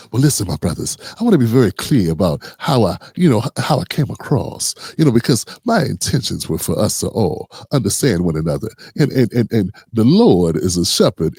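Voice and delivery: hammed-up smooth preacher voice; mock smooth talk. Pastor soothing his brothers, low and smooth. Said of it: "Talking all smooth now".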